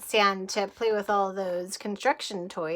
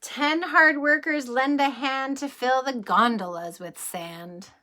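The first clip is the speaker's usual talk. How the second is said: lively rhyming read-aloud, a little louder; storybook reading; 5 semitones higher, 6 dB louder than usual. Acted. Reading a bedtime train book to children, lively read-aloud. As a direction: bounce through the rhyme for the kids.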